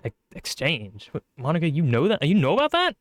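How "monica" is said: ordinary talk, nothing marked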